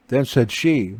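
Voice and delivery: usual speaking voice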